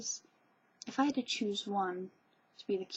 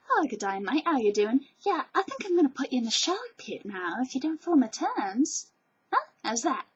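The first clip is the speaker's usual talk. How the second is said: friendly, lilting mock Australian baddie; Australian villain; 5 semitones higher, 6 dB louder than usual. Vlogger imagining an absurd Australian villain, congenial Australian accent. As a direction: threaten cheerfully in a put-on Australian accent. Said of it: "you could never have an Australian villain".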